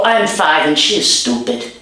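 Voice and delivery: usual speaking voice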